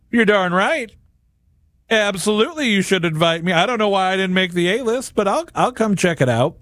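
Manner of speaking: a hammed-up, proud you're darn right; mock arrogance. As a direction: play the entitled guest who expected the invite. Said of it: "we're so arrogant and prideful".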